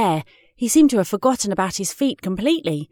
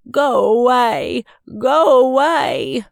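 slow groan, slightly raised; 3 semitones higher, 5 dB louder, slower than usual. A narrator's reading. Mum and Dad send Maisie away, groaning. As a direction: drag the words out in a groan. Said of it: "groaned Mum and Dad".

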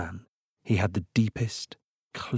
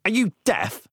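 rude retort; 10 semitones higher than usual. Acted. Blart rudely retorts to wizard.

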